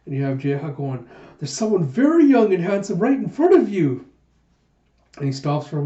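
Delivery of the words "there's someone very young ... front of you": high, loud, hammed-up insistence; indignant self-promotion. Pirate offering himself to the captain, loud insistent protest. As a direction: push yourself forward, voice raised in mock indignation.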